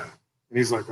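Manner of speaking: ordinary talk, nothing marked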